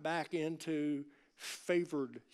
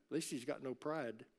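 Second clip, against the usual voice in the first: normal talking voice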